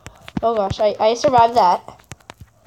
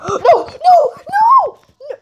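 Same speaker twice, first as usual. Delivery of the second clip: laughing shriek; 5 dB louder, slower than usual. Real, not acted. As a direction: gasp then high laughing no's. Laughing shock at outcome.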